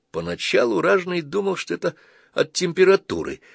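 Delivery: usual speaking voice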